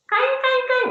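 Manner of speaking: high, repeated mock yelps; imitated dog yelp. Trainer telling a joke about a kicked sack, high fake dog yelps. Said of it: "oh anjing gitu ya".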